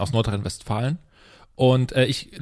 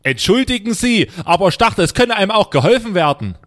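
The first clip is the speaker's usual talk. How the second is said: indignant resident; 6 semitones higher, 5 dB louder than usual. Acted. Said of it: "ich spreche quasi den Anwohner".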